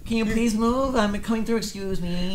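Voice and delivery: high, mock-polite plea; polite request. Passer-by announcing he's coming through, polite request. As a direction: pitch it up, over-courteous. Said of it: "I like to say it like".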